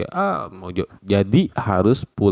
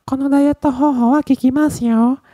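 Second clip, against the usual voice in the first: falsetto woman's voice, much higher; feminine voice. Acted. Teacher demoing feminine speech for a diet ad. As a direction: squeak it out like a girly ad. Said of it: "bahasa perempuannya".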